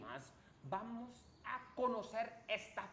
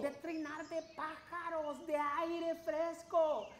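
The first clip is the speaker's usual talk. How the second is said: put-on bright, upbeat children's host; kids show host; 5 semitones higher, 7 dB louder than usual. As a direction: welcome the little ones cheerfully. Host greets kids in forest.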